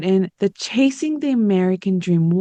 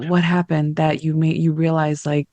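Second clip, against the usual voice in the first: ordinary talk, nothing marked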